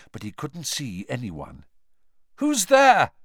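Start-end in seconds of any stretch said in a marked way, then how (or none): 2.3-3.1 loud, high call. A narrator's reading. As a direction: call out into the darkness. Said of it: "he called again".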